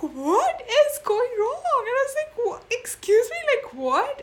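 high, loud retold disbelief; incredulous. Woman retells her date asking for her food, incredulous. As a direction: shoot the voice way up in acted shock.

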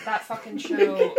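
ordinary delivery

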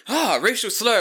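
high, loud startled cry; exaggerated gasp of alarm; mock shock. Passer-by reacting to a screamed slur, mock-shocked. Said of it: "shocked me it was so loud".

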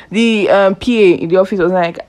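ordinary talk

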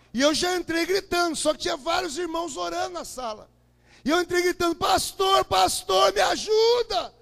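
high, loud cries for the pastor; retold cry for help, full volume; desperate shouting. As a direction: call out in panic. Bursting in crying for help.

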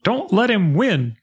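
high, urgent call to Riku; the king's yell. Retold or imitated. Host retelling the king urging Riku, in a high mock yell. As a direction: call out to him from far away, urging him on. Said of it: "the sound of the king yell".

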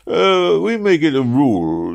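high, loud official protest; exclaiming. A narrator's reading. Chief Office man citing his rule, exclaiming. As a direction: burst out with a drawn-out objection. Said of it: "he exclaimed".

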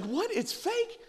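high, startled kid asking what, it's fake; a twelve-year-old learning wrestling is fake, stunned; shocked disbelief. Boy to his dad at the wrestling, shocked.

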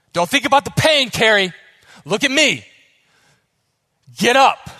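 put-on shouted Russian-accented pep command. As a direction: urge her up like a Russian coach. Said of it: "He's got, like, a Russian accent".

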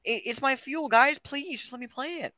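high pleading whine, fading out; whiny begging impression. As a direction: beg in a thin high whine. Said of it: "Elliot's like".

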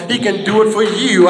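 ordinary talk

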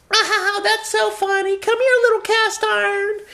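squealing, very high dog-lover voice; gushing falsetto. Acted. Passerby calls over dog named Cast Iron, gushing falsetto. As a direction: coo in exaggerated falsetto at a pet.

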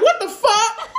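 high-pitched mock outrage; retold disbelief. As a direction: replay your shock in a high, incredulous voice. Acting out her shocked reaction.

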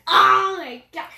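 loud, failed go at a deep voice; attempted deep scary voice. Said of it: "My voice can't even go that deep".

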